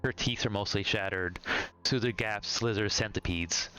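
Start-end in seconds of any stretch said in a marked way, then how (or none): none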